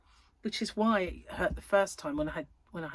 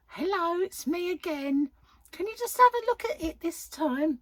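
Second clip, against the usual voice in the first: put-on little old lady voice; 11 semitones higher, 4 dB louder than usual. Vlogger mocking herself pestering her surgeon, high old lady voice. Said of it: "Why do I sound like, this is sounding like a little old lady version of".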